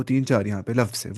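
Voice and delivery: ordinary talk, nothing marked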